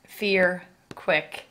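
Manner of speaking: ordinary delivery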